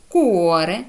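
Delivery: «куоре» opening on a hard k, drawn into uo; «куоре» as a teacher's reading example. Shown as a demonstration. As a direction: model «куоре» carefully with a clear k. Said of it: "читається як".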